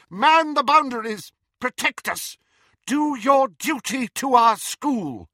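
high, loud battle orders; rally the troops at full pitch; rallying shout. A narrator's reading. Professor commands statues to protect the school, shouting. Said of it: "shouted Professor McGonagall".

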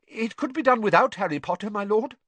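high, loud, nervous servant blurting; tumbling rush. A narrator's reading. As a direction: force it out fast before your nerve goes. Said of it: "words tumbling from him in a rush".

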